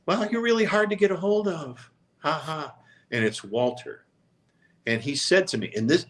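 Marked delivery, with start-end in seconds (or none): 0.1-2.7 lightly voiced thin small voice, higher; little voice. Dying Walter phoning the reporter, lightly voiced small teasing voice. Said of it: "there's this little voice saying".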